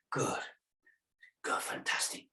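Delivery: whispered praise, barely voiced; soft whisper. Real, not acted. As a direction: breathe the praise out softly. Instructor praising quietly.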